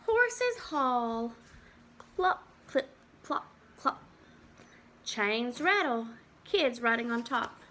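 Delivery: put-on lively storybook read for little kids; reading to children. Teacher reads a farm book to the class, lively storybook voice. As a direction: read it out like a picture book at circle time.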